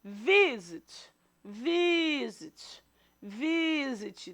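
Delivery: "visit" with a long, stressed "vi"; stress on first syllable. Shown as a demonstration. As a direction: stretch out the first syllable of "visit" each time. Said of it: "a sílaba mais forte é o V". ("visit" com o "vi" forte e alongado)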